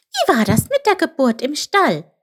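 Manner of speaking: high-pitched eager little questioner; Chip trying again. A narrator's reading. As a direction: ask in a high squeaky voice. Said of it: "versuchte Chip es noch einmal".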